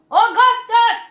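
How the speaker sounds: high, loud call; crying out. Acted. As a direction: bellow the boy's name. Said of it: "cried Mister Gloop".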